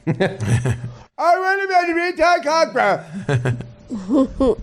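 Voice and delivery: very high, drawn-out caller voice; Gary. Acted. Radio caller Gary protests his new name, high-pitched caricature. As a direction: squeal it slowly as a comic caricature.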